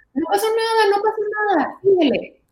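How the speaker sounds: put-on high, loud cheery brush-off of a fallen child; dismissive parent. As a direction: imitate a parent chirping it's nothing, keep going.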